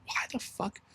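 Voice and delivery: hushed, breathy players' grumble; stunned locker room whisper; whispered disbelief. Retold or imitated.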